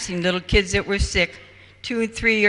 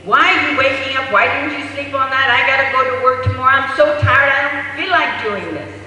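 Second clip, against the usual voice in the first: higher, louder, fast exhausted scolding; mother yelling at baby; 4 semitones higher, 6 dB louder, faster than usual. Retold or imitated. Mother scolding her waking baby, fast and louder. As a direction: rattle off an angry tired rant at a baby. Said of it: "yells at the baby".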